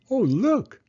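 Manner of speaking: put-on high, loud excited call of a boy; excited cry. A narrator's reading. Boy calls to his sister, excited cry. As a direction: point it out with a bright cry. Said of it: "cried Toto".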